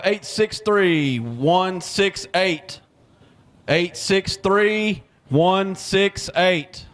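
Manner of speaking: slow number call. Real, not acted. Raffle number read out slowly.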